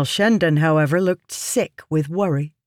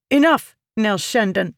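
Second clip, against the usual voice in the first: sharp, raised rebuke; snapping; 6 semitones higher, 3 dB louder than usual. A narrator's reading. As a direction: cut him off sharply. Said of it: "Yasna snapped".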